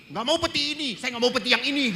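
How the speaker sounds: high, loud complaining whine; mock fussy corpse. Priest joking about a picky corpse, high whine. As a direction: play a picky dead man refusing his coffin. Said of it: "Jangan-jangan nanti dimasukin ke peti".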